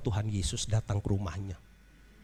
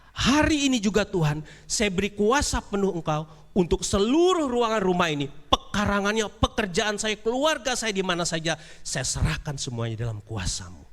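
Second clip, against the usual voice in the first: high, urgent pleading offer; raised, fervent vow; eager surrender; 8 semitones higher than usual. Acted. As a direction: hand it all over in a rush. Rich man surrenders everything.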